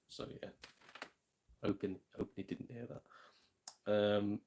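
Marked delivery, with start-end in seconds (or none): 0.1-3.0 keeping voice down. Real, not acted. Hushing so the kid upstairs can't hear the surprise. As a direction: talk low, secretive, not to wake the house. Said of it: "so I don't wanna spoil it for him".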